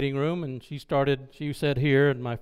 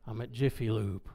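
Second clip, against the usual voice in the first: low, softer whine of objection; hushed, lower mock protest; reluctant protest; 4 semitones lower, 7 dB quieter than usual. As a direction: mumble a reluctant excuse, pitched down. Reluctant protest to Holy Spirit's prompting.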